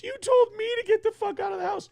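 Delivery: high, whiny mock of his wife. Husband mocking his wife's reply in a high whine. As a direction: parrot her back in a silly high squeal. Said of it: "And she goes".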